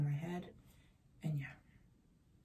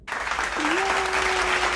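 loud, high sarcastic yay; over-the-top fake celebration; mock cheer; 12 semitones higher, 13 dB louder than usual. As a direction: cheer at your own failure. Sarcastic cheer about undone essay.

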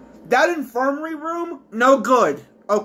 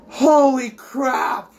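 usual speaking voice

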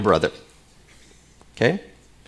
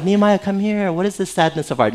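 sweetly sympathetic take; mock-compassionate king; 6 semitones higher than usual. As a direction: coax gently, as a caring king would. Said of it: "And it's not like that".

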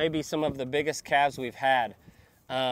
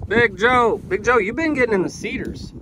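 calling out; 6 semitones higher, 4 dB louder than usual. Real, not acted. Calling out to the bull.

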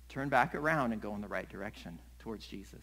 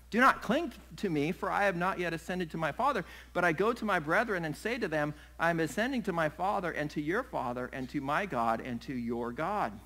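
raised, forceful reading of Jesus' words; emphatic scripture reading; 4 semitones higher, 5 dB louder than usual. Acted. Jesus speaking to Mary, in an emphatic scripture reading. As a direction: read the verse out firmly.

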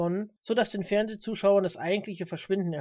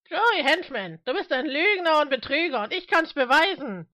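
high, loud public accusation; raised, fired-up accuser's voice; Mister Smith, accusing; 9 semitones higher, 8 dB louder than usual. Acted. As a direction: call him out at full voice, pitched high.

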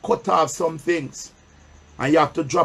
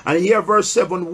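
ordinary talk

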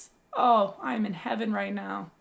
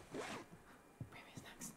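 soft slow whisper; 23 dB quieter, much slower than usual. Real, not acted. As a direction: whisper it to yourself. Whispered aside to himself.

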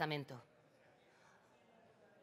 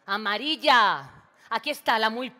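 shout; 3 semitones higher, 13 dB louder than usual. Acted. María bursts in shouting about the dead bee. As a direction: yell the discovery across the room. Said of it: "No grites".

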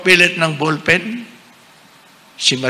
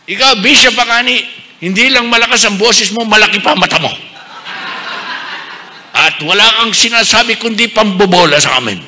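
louder mock-angry scolding; angry outburst; 7 semitones higher, 6 dB louder than usual. Pretend-angry Mariel scolding the bishop in front of the crowd. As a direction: play the furious parishioner, half in jest. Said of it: "at sa laki ng galit niya".

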